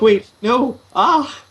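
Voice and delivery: high, mock-distressed outcry; retold shock. Reader recalling a story's ending, acting out shocked protest. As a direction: act out dismayed disbelief, climbing to a cry.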